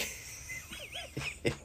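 squeaky breathless laugh; high giggle. Real, not acted. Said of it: "that's why I'm laughing so hard".

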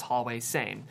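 ordinary talk

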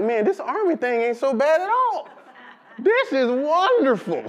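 high-pitched delighted recruit; naive delight. Retold or imitated. Retells naive delight at army.

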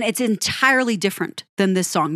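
ordinary delivery

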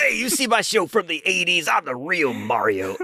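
hammed-up TV cartoon character; Mario from the Super Show. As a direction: start high, then drag out the boast. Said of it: "getting the Mario from Mario Super Show".

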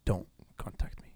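breathy mock whisper. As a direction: whisper it like a secret aside.

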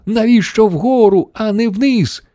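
high, alarmed questioning cry; storybook shriek of bafflement; screamed chorus. A narrator's reading. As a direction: squeal the question in protest. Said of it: "заверещали всі хором".

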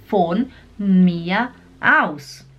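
very slow, words far apart; slow demonstration. Shown as a demonstration. Teacher repeating the phrase for learners, very slow and spaced. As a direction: say it word by word, drawn out. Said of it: "Vamos falar devagar?".

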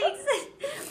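laughing through a playful thank-you; laughing mock thanks. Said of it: "I was like".

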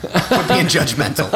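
loud, high mock scolding with laughter; playful call-out. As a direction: jokingly call out an absent critic. Playful call-out to absent girlfriend.

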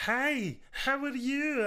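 high mock girl voice. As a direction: mimic her text in a high voice. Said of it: "she's like".

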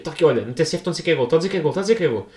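fast, urgent, repeating it to friends; giddy fan spilling the goal call; excited rush. Retold or imitated. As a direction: blurt the radio news at double pace. Excitedly telling friends the radio.

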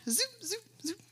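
high zooping sound effect; mock swoosh. Preacher imitating the strange woman's swoop, high. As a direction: mimic a quick swoop.

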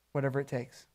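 very soft, low murmured prayer; hushed surrender. Acted. Listener finally at that moment, hushed surrender. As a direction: say it under your breath, giving in.

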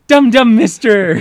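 high, loud, slow delighted cry; delighted gasp. Retold or imitated. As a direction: gasp it out with child joy. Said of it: "this happy gasp".

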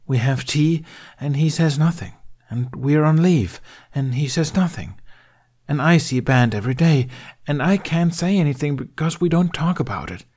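high, tense grieving rant; distressed outpouring. Acted. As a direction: pour out the hurt. Emotions tumbling out into the open.

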